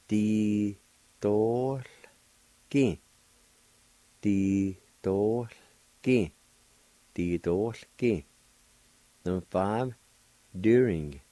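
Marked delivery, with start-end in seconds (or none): 0.1-8.3 "Di-tol-ki" in three slow separate beats; slow syllables. Shown as a demonstration. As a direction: model "Di-tol-ki" slowly, beat by beat. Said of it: "it's pronounced like this".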